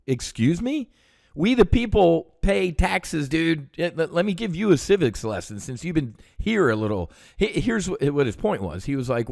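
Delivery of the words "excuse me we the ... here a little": higher-pitched, affronted comeback; indignant retort. Retold or imitated. Veteran scolds a congressman over taxpayers' money, indignant and higher. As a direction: retell it as an incredulous telling-off.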